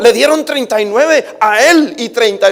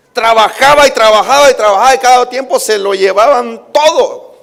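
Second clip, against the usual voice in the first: fervent preaching; 4 semitones higher than usual. Acted. Preacher telling the church about Israel's oppression, fervent preaching. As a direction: build the story with fervor.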